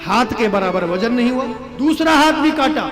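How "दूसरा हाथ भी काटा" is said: high, loud, forceful storytelling; impassioned climax. Real, not acted. Preacher telling of the king cutting his own body, impassioned. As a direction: build to a shouted climax, slowing at the end.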